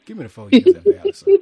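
high, loud, fast plea; hammed-up defendant begging; imitating convict. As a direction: beg the judge in a rush. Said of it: "You be like".

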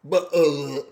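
slow, hesitant politician impression; Boris Johnson. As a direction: bumble through it as the politician. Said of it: "Boris Johnson here".

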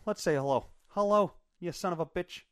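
ordinary talk

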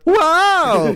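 very high, loud mock "Whoa"; goofy house groaning as it settles; talking house. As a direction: give the house a squealing cartoon voice. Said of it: "and the house went".

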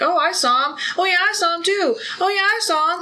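high, loud mock chatter of eager witnesses; hammed-up nosy townsfolk piling on; mocking gossipy witnesses. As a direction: play each witness chiming in eagerly. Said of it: "and they're like".

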